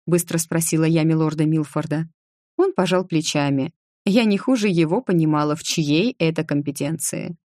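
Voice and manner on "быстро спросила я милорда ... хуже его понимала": usual speaking voice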